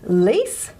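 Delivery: the "lease" of "police" said alone, long and rising; stressed second syllable. Shown as a demonstration. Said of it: "the lease is a stressed syllable".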